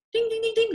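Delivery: high ringing "ding" on one held note; handbell imitation. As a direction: chime like a bell calling for attention. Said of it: "like as if he was ringing a bell".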